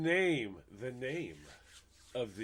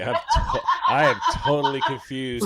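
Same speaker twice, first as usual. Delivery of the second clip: laughing confusion; 11 dB louder than usual. Real, not acted. Host reacts loudly to guest's Canadian Italian remark, laughing.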